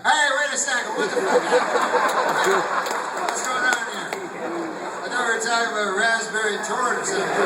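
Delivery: high, loud comic disbelief; alarmed protest. Acted. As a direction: burst out as a startled, hammed-up diner. Alarmed diner objects to waiter.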